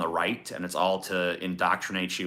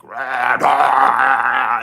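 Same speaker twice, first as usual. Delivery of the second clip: growled rah rah, louder on the second; mock metal roar; 3 semitones higher, 9 dB louder than usual. Shown as a demonstration. Showing what heavy music means, a mock metal roar. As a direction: ape a headbanging metal yell. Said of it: "headbangy shit".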